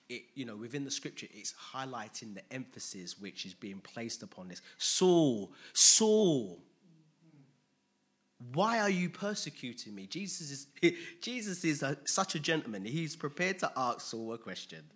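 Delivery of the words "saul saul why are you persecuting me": loud calling out of the name. Acted. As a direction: call Saul loudly from heaven. Voice of Jesus calling Saul.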